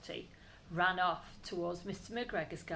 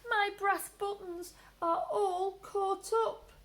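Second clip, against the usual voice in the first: crying; 12 semitones higher, 5 dB louder, slower than usual. Acted. Trapped Peter crying.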